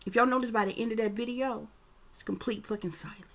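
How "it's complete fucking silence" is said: whisper. Real, not acted. Stressing the video's dead silence.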